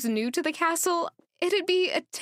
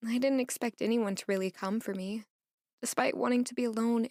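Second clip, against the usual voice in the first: ordinary delivery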